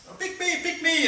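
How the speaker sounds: high squeaky pleading voice; eager prisoner. Retold or imitated. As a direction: beg like a kid in class. Prisoners begging the guards.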